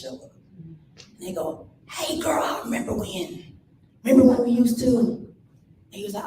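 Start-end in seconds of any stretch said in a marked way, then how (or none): 1.9-5.2 put-on old friend. Old friend voiced in story.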